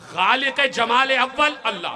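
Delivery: shouted high, rising to a peak; fervent shout. Real, not acted. Preacher shouting praise to the congregation. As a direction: call it out in praise.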